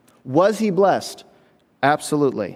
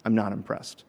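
unimpressed God; 7 semitones lower, 9 dB quieter than usual. Retold or imitated. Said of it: "God shakes his head and says".

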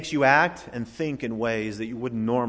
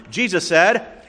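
ordinary delivery

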